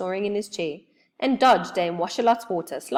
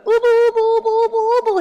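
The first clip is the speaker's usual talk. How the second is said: much higher, loud alarmed oobling; frightened cry; 9 dB louder, faster than usual. Acted. Moonface grabs Joe and cries out in fright. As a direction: cry out in alarm through stuck teeth. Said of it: "he cried".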